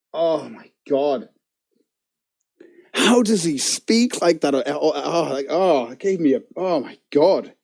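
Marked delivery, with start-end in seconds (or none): none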